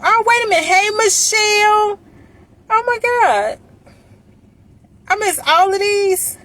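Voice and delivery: very high excited squeal; delighted surprise. Real, not acted. Spots more friends in comments.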